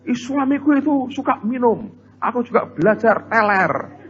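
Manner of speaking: high squeaky wife, ridiculed; mocking a foolish wife. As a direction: make her excuse sound silly. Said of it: "Bukan terus". (meniru istri konyol dengan suara tinggi)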